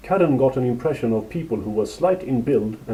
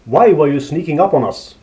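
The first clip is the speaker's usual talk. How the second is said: louder, accusing demand; interrogating; 4 semitones higher, 6 dB louder than usual. A narrator's reading. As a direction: press hard for an answer. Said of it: "interrogated the other".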